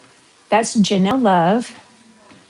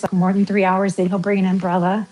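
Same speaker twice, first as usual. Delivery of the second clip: ordinary delivery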